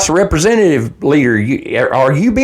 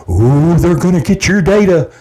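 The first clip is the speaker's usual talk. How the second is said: mocking whine. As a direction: parody their fuss, lightly scornful. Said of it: "the only thing the Democrats can do is complain about".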